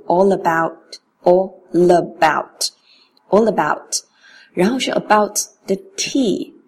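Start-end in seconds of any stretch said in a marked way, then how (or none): 0.1-4.0 "all about" run together as "allabout"; linked all about. Shown as a demonstration. Teacher showing the linking of "all about". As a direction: roll the L straight into "about". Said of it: "它跟about连读".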